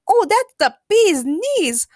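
high, loud, drawn-out Harley squeal; cheering. A narrator's reading. Harley sees the Joker's world, cheering. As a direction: cheer slowly and gleefully, pitched high. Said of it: "Harley cheered".

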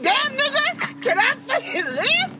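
high, slow, drawn-out exasperation; exasperated outburst. Retold or imitated. To people policing her tweets, high drawn-out exasperated plea. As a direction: stretch it out way up high, fed up. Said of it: "I'm like".

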